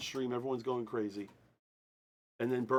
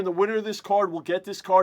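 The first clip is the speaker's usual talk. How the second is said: raised, measured announcing voice; formal announcer; 5 semitones higher, 8 dB louder than usual. Real, not acted. Host opening the draw rules for viewers, announcer voice. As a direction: open an official announcement.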